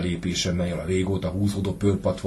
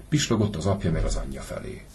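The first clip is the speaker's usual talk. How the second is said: ordinary delivery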